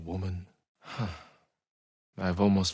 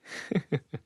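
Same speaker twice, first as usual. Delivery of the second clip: short laugh; 9 semitones higher than usual. Real, not acted. Amused memory in the bath.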